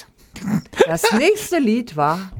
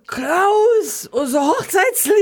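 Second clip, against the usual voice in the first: mock yell; 10 semitones higher, much slower than usual. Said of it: "ich brülle".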